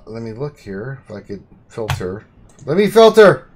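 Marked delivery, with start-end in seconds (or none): 2.6-3.5 loud, high burst of excitement; excited shout. Real, not acted. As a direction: blurt it out with glee. Excited while filtering roster.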